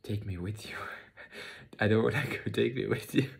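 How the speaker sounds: acted tearful plea, breathy then bursting out loud and high; re-enacted sobbing. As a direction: mimic sobbing, building from a whisper to a high cry. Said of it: "I started sobbing, and I said".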